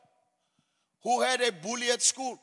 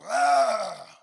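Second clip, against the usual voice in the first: usual speaking voice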